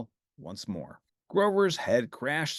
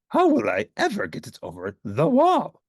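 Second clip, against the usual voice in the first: Grover voice; 6 semitones higher, 6 dB louder than usual. Acted. Grover straining.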